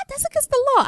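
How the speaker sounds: squeaky naive outrage; shocked roommate learning about segregated fraternities; high mock outrage. Roommate voiced in retold story.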